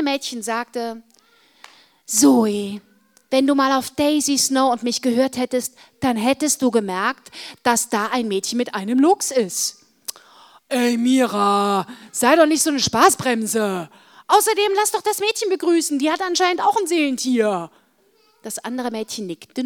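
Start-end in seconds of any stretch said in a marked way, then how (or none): 2.1-9.8 loud telling-off of a friend; exasperated, forceful girl's scolding. Acted. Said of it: "von dem anderen an-, Mädchen angeschimpft". 10.7-17.7 loud, protesting retort; arguing back. Acted. Girl argues back at her friend.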